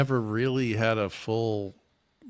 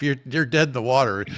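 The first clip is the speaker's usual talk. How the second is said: usual speaking voice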